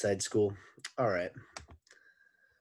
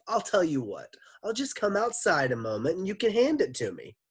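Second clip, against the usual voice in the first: polite teacher voice; 10 semitones higher, 5 dB louder than usual. A narrator's reading. Gorf polite voice.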